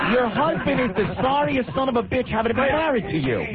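high, heated insult at the caller; shouting insult. Real, not acted. Host yells insults at caller.

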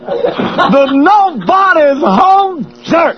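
high, slow stand-up holler; Chris Rock. Acted. Host riffing on being home alone, Chris Rock impression. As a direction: work the crowd like a shouting comedian. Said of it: "That's Chris Rock".

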